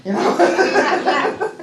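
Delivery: high, loud, through laughter; real laughing. Real, not acted. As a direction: crack up at your own joke. Laughing at her own story.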